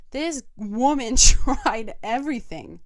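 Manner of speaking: laughing. Real, not acted. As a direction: break into a real laugh mid-sentence. Laughing about her mom.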